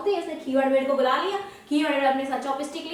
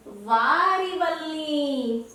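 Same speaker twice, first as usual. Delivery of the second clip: "वारी" stretched very slowly with a falling tune; much slower than usual. Shown as a demonstration. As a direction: spell out "वारी वल्ली" word by word, very slowly. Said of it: "बाली नहीं". ("वारी वल्ली" बहुत धीरे, खींचकर)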